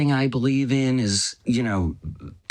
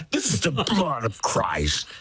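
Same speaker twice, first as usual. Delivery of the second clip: horror-movie priest; 3 semitones higher than usual. Retold or imitated. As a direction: intone it like a ghoulish horror-film priest. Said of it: "like a three D horror movie".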